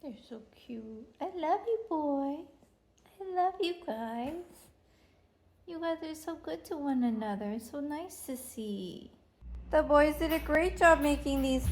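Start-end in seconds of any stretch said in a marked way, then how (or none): 0.0-9.1 tender praise. Real, not acted. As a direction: hush down and adore them. Mother praising her sons baking.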